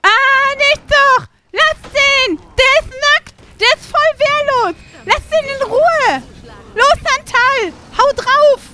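high, loud urgent yells; shouting at a companion to leave the ghost alone; frantic shouting. Real, not acted. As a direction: stop the attack at all costs. Yelling to stop attack.

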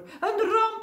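high, loud shriek of terror; squealed cry of alarm; panicked shriek. A narrator's reading. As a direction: shriek it like disaster has struck. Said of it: "gilde hij".

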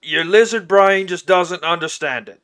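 loud, dismissive orc voice; condescending orc. Acted. Orc talks down to the player.

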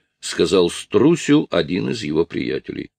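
ordinary delivery, nothing marked

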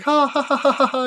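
high, hammed-up fake laughing; mock laughter. People laughing along at cussing, mock high laugh. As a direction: laugh it off like clueless coworkers, high. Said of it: "people would just be like".

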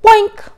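high loud comic bonk; cartoon boink. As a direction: pop the sound effect out high. Ball hits Howard.

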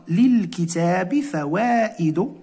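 للكتاب فوائد read slowly, stress on للكتاب; slow model sentence. Shown as a demonstration. Teacher reading example to class, slow. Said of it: "ممكن نقولوا في جملة".

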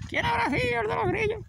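high put-on voice of an annoyed teacher; boarding school teacher wondering who did it; teacher's voice. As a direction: ask it like the puzzled dorm teacher. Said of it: "no los podían coger".

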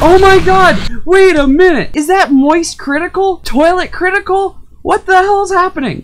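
high, loud disbelieving yells; excited shouting. Real, not acted. Gamer yelling at a surprise boss, excited. Sees new boss appear.

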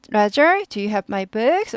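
Roger lifted, then books climbing at the end; rising question. Shown as a demonstration. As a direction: slowly ask it, raising the end of books. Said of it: "올라가죠". (Roger와 books 끝을 올려 묻기)

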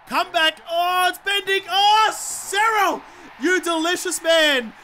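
high, loud, drawn-out cheer; will the ball in, then exult; thrilled celebration. Real, not acted. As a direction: ecstatic goal call, stretched out. Celebrates a curling goal.

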